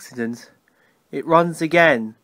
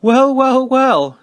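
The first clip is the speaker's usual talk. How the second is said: amused surprise; 8 semitones higher, 4 dB louder, much slower than usual. A narrator's reading. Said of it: "he explained".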